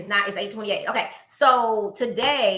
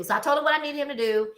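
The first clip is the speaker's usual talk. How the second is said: ordinary delivery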